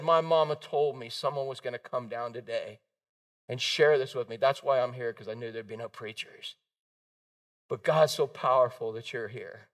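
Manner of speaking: low, soft, subdued quote; understated imitation of a broken-down man; tearful confession retold. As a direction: confess it quietly, dying away at the end. Retells crying biker's confession.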